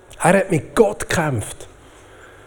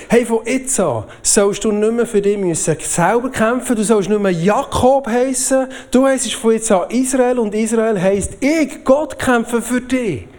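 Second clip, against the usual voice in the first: raised pitch, rousing preacher delivery; emphatic divine declaration; 7 semitones higher than usual. Retold or imitated. God speaking to Jakob through the preacher, emphatic declaration. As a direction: proclaim it as God's promise, high and insistent.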